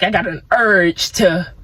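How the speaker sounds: slow, low, dopey voice trailing off; lightly voiced goofy craving; mock urge voice. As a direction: drawl it like a silly craving. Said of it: "'cause an urge is something that you get, like".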